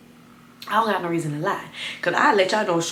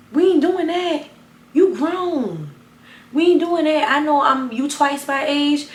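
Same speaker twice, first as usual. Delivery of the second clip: higher and louder, telling her off; heated scolding; 7 semitones higher, 4 dB louder than usual. Retold or imitated. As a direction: put a grown woman in her place. Retelling telling off the mother.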